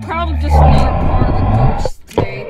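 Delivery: deep booming ghost voice, quick. Acted. Ghost tape voice speaking.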